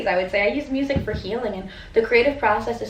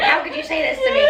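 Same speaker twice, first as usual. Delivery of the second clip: mock hurt; 14 semitones higher than usual. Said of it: "shocked and disappointed".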